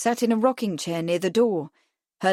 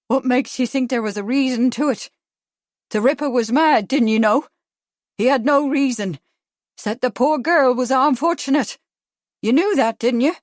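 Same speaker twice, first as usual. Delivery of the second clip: higher, indignant brogue; dark anger; 4 semitones higher, 5 dB louder than usual. A narrator's reading. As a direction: challenge them in angry disbelief. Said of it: "was now dark with anger".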